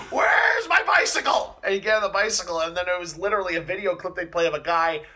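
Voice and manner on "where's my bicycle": much higher, louder and slow mock shout; hammed-up cry. Retelling a Conan bit, slow high mock shout. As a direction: drag it out high and loud like a silly demand. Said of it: "he'd be like".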